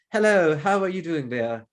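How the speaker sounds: loud, drawn-out Queen's English greeting; posh RP accent. Acted. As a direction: put on a slow, grand posh English accent. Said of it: "like, really posh".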